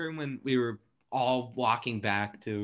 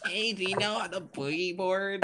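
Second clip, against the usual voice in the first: mock old woman; 6 semitones higher than usual. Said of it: "No context".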